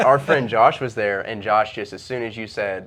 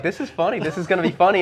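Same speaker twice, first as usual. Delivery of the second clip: gleeful aside; 8 semitones higher than usual. Retold or imitated. Narrator retells his own gleeful thought.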